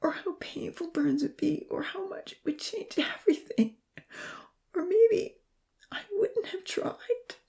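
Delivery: high, cracking voice that slows at the end; voice breaking. A narrator's reading. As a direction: let the voice crack and give way. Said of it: "His voice broke".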